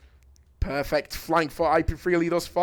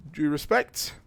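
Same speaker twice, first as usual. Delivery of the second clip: normal talking voice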